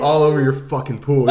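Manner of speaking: loud mock threat, half laughing; lightly acted self-directed rage; imitated angry actor. Actor threatens himself in the mirror, loud imitated anger. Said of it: "You're really good at this impression".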